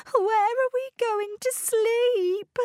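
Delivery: very high, plaintive wail; tearful wail. A narrator's reading. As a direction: act out crying in distress. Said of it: "she began to cry".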